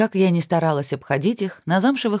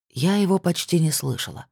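ordinary delivery

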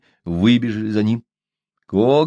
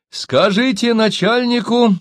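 high, slow, deliberate call; storyteller voicing a shouted message; shouting across; 10 semitones higher, slower than usual. A narrator's reading. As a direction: call each phrase out long and high. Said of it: "крикнул Диан по-китайски".

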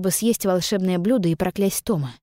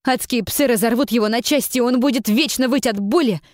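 rising, louder, fierce curse; furious vow; 5 semitones higher, 3 dB louder than usual. A narrator's reading. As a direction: hurl the threat, building higher. Jin vows revenge on Tom. (яростная клятва мести)

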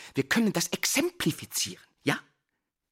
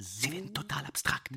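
whisper; 8 dB quieter than usual. Acted. Teacher whispers as words dissolve.

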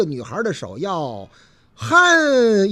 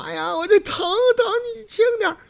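put-on high pained girl's plea; 姑娘嚷嚷; 12 semitones higher than usual. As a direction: whine for him to be gentle. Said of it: "嚷嚷". (姑娘嚷着喊疼)

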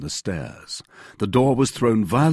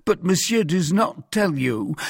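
high-pitched, anxious protest; crying out; 10 semitones higher, 4 dB louder than usual. A narrator's reading. Mercer tries to explain to the guards, crying out. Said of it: "cried the mercer".

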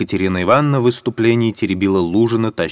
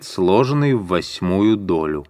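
ordinary delivery, nothing marked

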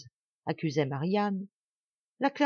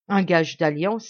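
slow, louder, scornful repeat of the words; scornful outcry; 6 dB louder than usual. Acted. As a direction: throw his words back at him with contempt. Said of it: "s'écria-t-il". (reprise méprisante, lente et plus forte)

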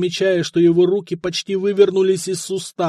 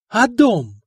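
harsh; 7 semitones higher, 5 dB louder than usual. A narrator's reading. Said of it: "жёстко спросил нотарий".

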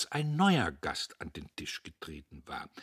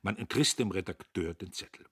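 ordinary delivery

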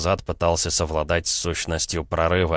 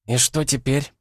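put-on higher-pitched, composed girl's question; lightly voiced calm girl, pitch lifted; 7 semitones higher than usual. A narrator's reading. Girl asks from the stairs what happens now, calmly. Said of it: "раздался с лестницы тихий, спокойный голос Алисы".

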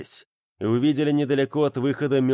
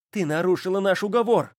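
high, indignant outburst; angry accusation; 9 semitones higher than usual. A narrator's reading. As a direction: accuse her sharply. Graka accuses Lilith.

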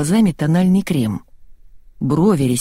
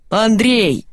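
higher, drawn call; yelled name; 5 semitones higher than usual. A narrator's reading. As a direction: moderate exasperated shout. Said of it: "заорал Алик".

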